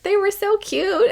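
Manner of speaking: very high, gushing mom imitation; high-pitched mock-emotional cooing; silly emotional mom. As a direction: gush like a silly, sentimental mom. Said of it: "getting emotional in, like, a silly mom way".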